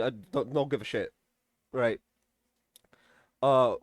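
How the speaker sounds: normal talking voice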